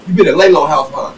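loud, high accusing question; dad flipping out. Retold or imitated. Father confronting his high son, loud angry accusation. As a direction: snap it at him, furious father. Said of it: "he instantly flips out".

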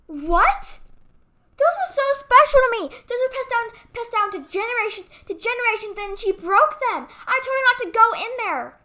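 loud, hurt outburst over broken treasures; angry and upset. Acted. Toy owner complains about friend breaking displays, loud and angry. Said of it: "I'm very mad now".

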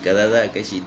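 ordinary delivery

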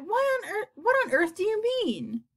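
high incredulous question; cried out. A narrator's reading. Creatures challenging the ladybug, high incredulous cry. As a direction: ask in high-pitched disbelief. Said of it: "they cried".

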